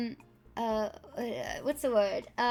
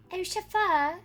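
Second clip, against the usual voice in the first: posh rich lady; 4 semitones higher than usual. Pretend. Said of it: "rich lady orders chauffeur".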